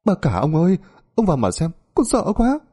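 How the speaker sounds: high-pitched panicky pleading; flustered, frightened servant. Acted. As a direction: beg the master to come, voice climbing. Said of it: "làm gì mà mày cuống hết cả lên". (giọng người ở cuống cuồng, sợ hãi)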